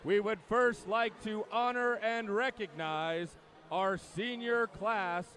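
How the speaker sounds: big, drawn-out PA announcer welcome; formal announcer's voice, stretching the key words; meet announcer. Acted. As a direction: slowly roll out each phrase like an arena announcer. Announcer opens swim meet.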